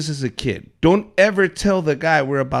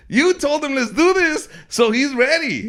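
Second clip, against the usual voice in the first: high, insistent coach voice; high-pitched exasperated explaining; arguing coach; 9 semitones higher than usual. Retold or imitated. Acts out coach arguing back.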